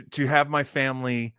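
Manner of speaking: normal talking voice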